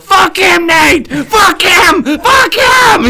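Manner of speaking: put-on high, full-throated yell in a thick German accent; imitated German coach yelling. German coach to his player mid-drill, yelling. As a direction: holler it across a field like a German coach. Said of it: "he's just yelling across the field".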